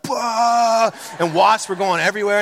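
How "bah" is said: mock angry blast. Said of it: "I was just like".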